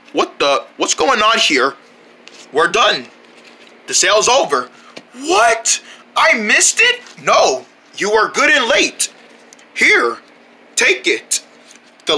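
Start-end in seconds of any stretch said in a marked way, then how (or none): 5.1-7.0 surprised. Acted. Surprise at missed sale.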